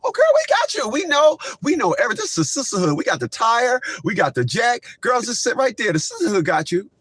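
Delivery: high mocking girlfriend squeal; hammed-up high sassy helpers; mock sisterhood voice. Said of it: "just be like".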